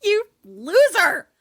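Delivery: high, loud, hammed-up insult; mock jeer. Player joining in on the gunman's insult, jeering. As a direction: throw the insult out at full voice.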